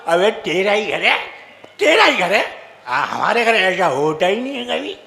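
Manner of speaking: put-on low slurred drunk mate; drunk friend. Drunk friend insists it is his pal's house, low and slurred. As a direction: insist like a tipsy pal. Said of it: "उसका दोस्त बोला".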